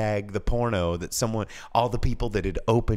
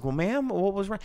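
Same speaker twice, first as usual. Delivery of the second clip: polite clerk; 12 semitones higher than usual. Retold or imitated. Clerk voicing himself to customer.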